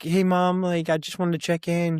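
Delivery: lightly acted call to mom; retold cheery greeting. Daughter phoning her mom to check in, retold cheery greeting.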